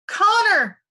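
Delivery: high desperate cry for brother. A narrator's reading. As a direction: scream the name in alarm. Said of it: "Nichelle cried to her brother".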